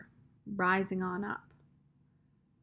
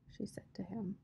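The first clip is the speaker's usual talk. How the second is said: plain reading voice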